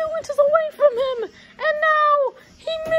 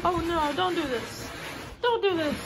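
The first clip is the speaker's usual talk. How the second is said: usual speaking voice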